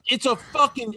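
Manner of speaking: high, testy protest; pissy. Retold or imitated. Comedian dad insisting to his daughter, pissy. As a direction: re-enact an indignant defence, pitched right up. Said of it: "I get fucking pissy".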